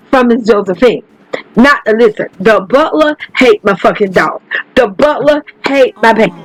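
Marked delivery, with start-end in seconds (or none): none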